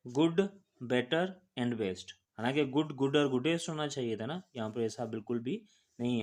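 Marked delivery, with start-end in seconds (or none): 0.1-2.1 slow recitation. Shown as a demonstration. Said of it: "teacher recites the irregular forms".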